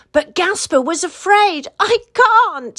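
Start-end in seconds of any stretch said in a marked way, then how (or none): 1.8-2.8 high, loud scared cry; frightened cry. A narrator's reading. Gasper staring into the dark sea, crying out in fear. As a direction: cry out, too afraid to jump in. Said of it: "he cried".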